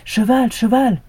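high, loud call to the horse; a last try, call the horse. A narrator's reading. The dog calls the horse in a high, loud cry. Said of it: "dernière tentative".

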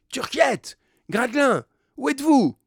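high, loud shouts for missing men; calling out. A narrator's reading. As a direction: call urgently across the distance. Calling for missing men after quake.

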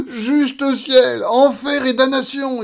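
high, loud cry of horror; terrified scream. Acted. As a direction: scream the oath in panic. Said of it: "hurla-t-il". (juron hurlé de terreur)

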